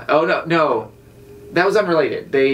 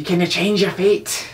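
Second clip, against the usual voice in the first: Brave movie Scottish quote. Retold or imitated.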